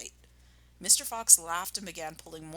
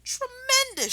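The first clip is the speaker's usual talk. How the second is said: much higher delighted exclamation; excited shout; 4 dB louder than usual. Acted. Badger shouting at the cider find. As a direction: cheer it out. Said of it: "shouted Badger".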